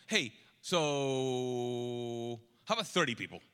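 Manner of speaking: long drawn-out "so", voice dropping low; hesitant haggling. Retold or imitated. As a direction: stall on "so" before sheepishly asking. Acting out Abraham's cautious haggling.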